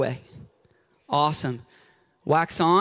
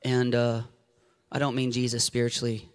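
ordinary delivery

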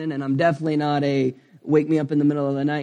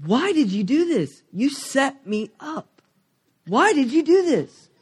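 much higher, pleading and accusing cry at God, slow in the middle; upset at God; 9 semitones higher, slower than usual. Retold or imitated. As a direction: throw the complaint up at God, voice climbing. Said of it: "being upset at God".

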